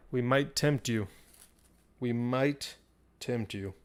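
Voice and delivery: usual speaking voice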